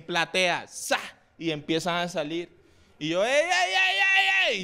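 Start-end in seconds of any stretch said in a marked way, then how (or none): 3.2-4.6 high-pitched urgent "hey" to stop people; calling out. Retold or imitated. Comic calling to audience leaving his show, high alarmed call-out.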